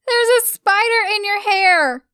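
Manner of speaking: high, loud panicked scream; screamed in alarm. A narrator's reading. Stella screams a fake warning about a spider. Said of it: "she screamed".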